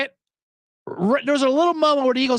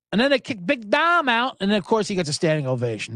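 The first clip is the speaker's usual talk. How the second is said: ordinary talk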